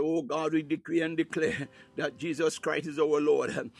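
ordinary delivery

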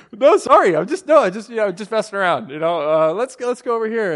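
high, loud hurried apology, stumbling; flustered backpedalling. Retold or imitated. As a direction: backtrack hastily with false starts. Retold flustered backpedalling to wife.

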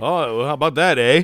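mock Canadian. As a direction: playfully imitate a Canadian, lifting the voice. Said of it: "the Amazing French Canadians are coming".

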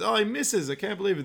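high, excited announcer call; hammed-up shock from the booth; mock commentator. Commentator calling the miss, mock excited.

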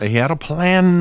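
high, hammed-up old preacher cry. Podcaster parodying a pulpit sermon, old preacher voice. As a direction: belt it out like an old preacher, stretching PLAN. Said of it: "I'm doing old preacher".

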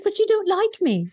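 playful imitation of the Love Actually scene; mock Keira Knightley. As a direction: tease her line in a lifted, breathy-free pretend voice. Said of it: "and she was like".

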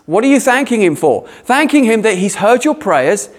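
ordinary talk, nothing marked

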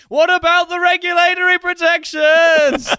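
very high, squeaky whining voice; centrist zombie. Retold or imitated. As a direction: whine it like a panicked centrist.

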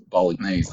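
bolognese with a firmly sounded G; hard G. Real, not acted. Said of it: "pronouncing the G so firmly".

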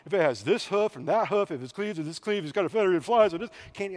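rattled-off, muddled list of rules; mock-overwhelmed gabble; exasperated reading. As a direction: mimic struggling through tedious rules, rushing at the end. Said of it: "it's hard to read".

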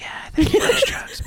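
playful conspiratorial whisper; mock-secret whisper. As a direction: whisper it back like shared gossip. Answers the hushed gossip in kind.

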